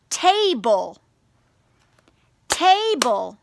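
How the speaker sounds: table in two drawn-out beats, ta-ble; syllable by syllable. Shown as a demonstration. Teacher asks which column, table syllable by syllable. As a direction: clap out table, stretching each syllable. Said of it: "practice clapping your syllables".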